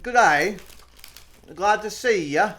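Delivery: high, loud, slow Aussie; loud high Aussie accent; mock Australian accent. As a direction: ham up an Australian greeting. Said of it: "That sounded Australian".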